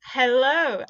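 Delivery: usual speaking voice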